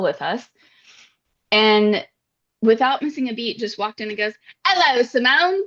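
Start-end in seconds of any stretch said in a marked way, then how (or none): 4.7-5.6 high-pitched, hammed-up British greeting; loud, affected British accent. Friend walking in on Simone, loud put-on British accent. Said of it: "Like, super loud. ... so much affect in that accent".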